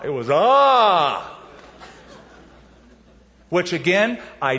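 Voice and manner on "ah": loud startled cry of fright; terrified scream. Retold or imitated. Disciples seeing a ghost, a loud frightened cry. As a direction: scream like you've just seen a ghost.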